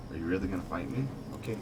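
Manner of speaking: normal talking voice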